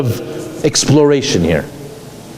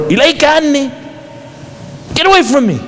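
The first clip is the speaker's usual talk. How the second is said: passionate distraught cry; 12 semitones higher than usual. Shown as a demonstration. Distraught woman pushing a stranger away, anguished cry. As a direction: fling the words away in raw grief. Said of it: "With such deep passion and emotion".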